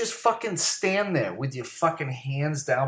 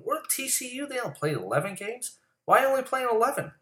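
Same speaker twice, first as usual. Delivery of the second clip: incredulous; 12 semitones higher than usual. Real, not acted. Disbelief at TCU's schedule.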